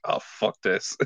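sighing. Retold or imitated. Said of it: "a big sigh like".

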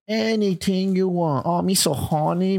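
high, slow mock Vietnamese accent. Quoting a war movie line in a mock Vietnamese accent. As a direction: ham up a broken-English Vietnamese accent. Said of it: "I'm allowed to do that accent".

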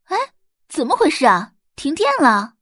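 suddenly loud, puzzled office worker; startled outcry. A narrator's reading. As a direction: shout in alarm as the lights die. Office plunges into darkness.

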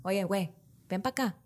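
South Texas Spanish banter; South Texas Tex-Mex slang, tossed off; South Texas accent. Shown as a demonstration. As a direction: imitate locals calling out in South Texas Spanish. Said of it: "That's what it is".

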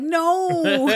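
high, loud pouty protest; a sulky, protesting no; a childish pouting refusal. Retold or imitated. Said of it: "It was like a pouty protest".

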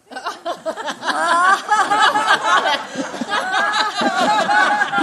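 a long high burst of laughter; cracking up at his own joke. Real, not acted. Preacher laughing hard at the telemarketer joke.

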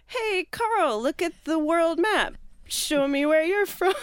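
high, over-eager stalling voice; hammed-up cheery cover-up; mock-bright distraction. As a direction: pitch it way up to divert a guest's attention. Acts out stalling Carl loudly.

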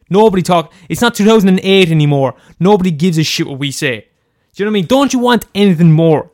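normal talking voice

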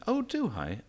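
high, airy mock surprise; imitating Nadine. Imitating the minister feigning surprise, high and airy. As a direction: play her up as coyly surprised. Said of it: "And she went".

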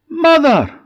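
high, loud call of Mother!; a delighted creature calling across the market; the fart spots his mother and calls out loud and high; joyful call. Acted. Little man calls out.

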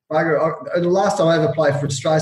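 ordinary talk, nothing marked

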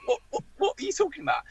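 small high voice, mock bewilderment; baffled stammer. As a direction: act out stammering confusion under attack. Baffled player answering the shouting manager.

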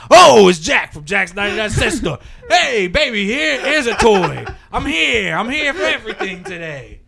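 loud, high excited shout through laughter; yelling. Retold or imitated. Store owner bursting into the office, shouting greetings, loud and laughing. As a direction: bellow the greeting like an overjoyed shopkeeper. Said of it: "And he's just yelling".

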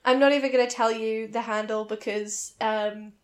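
normal talking voice

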